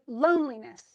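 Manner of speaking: loneliness with LONE extended; big, long LONE at the start of loneliness; stress on LONE. Shown as a demonstration. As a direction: stretch the first syllable of loneliness. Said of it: "lone is the big one".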